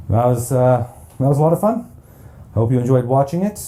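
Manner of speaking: happy wrap-up after the heroes win; excited. Real, not acted. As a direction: celebrate the victory out loud. Game ends, heroes win.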